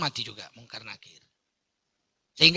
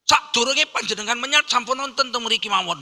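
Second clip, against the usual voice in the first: higher-pitched jinn speaking Javanese; 6 semitones higher, 4 dB louder than usual. Acted. Jinn offers King Solomon his service, mock Javanese voice. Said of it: "Gitulah kira-kira kalau jinnya Jawa".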